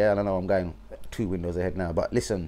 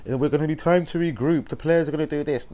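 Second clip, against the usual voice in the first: mocking super fans; 5 semitones higher than usual. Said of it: "super fans".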